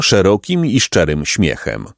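ordinary delivery, nothing marked

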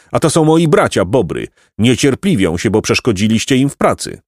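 usual speaking voice

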